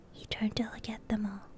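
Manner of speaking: usual speaking voice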